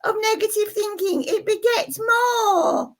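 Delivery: very high, squeaky puppet character lecturing. Acted. Puppet explains negative thinking to the viewers, very high squeaky voice. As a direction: pitch way up for the little puppet. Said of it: "it's a puppet show".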